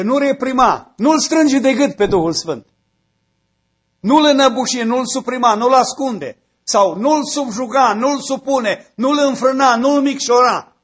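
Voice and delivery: building sermon cry; preaching at full force. Acted. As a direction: thunder it from the pulpit to stir the church.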